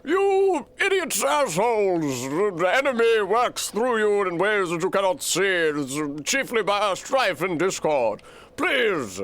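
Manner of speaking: put-on an old wizard lecturing squabbling fools; scolding Gandalf. Streamer voicing Gandalf scolding the company, slow high wizard voice. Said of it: "Gandalf is like".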